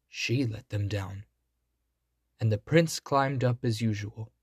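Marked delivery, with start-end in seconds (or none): none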